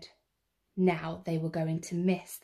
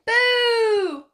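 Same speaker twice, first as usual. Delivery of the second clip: high, loud sudden boo; shouted boo; 14 semitones higher, 9 dB louder than usual. Acted. Cub scaring the sunbathing walrus, loud boo. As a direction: leap out with it.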